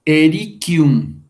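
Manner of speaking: slow, measured Latin example word; drawn-out, deliberate "erikium"; word shown slowly. Shown as a demonstration. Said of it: "Vamos a ver esa palabra".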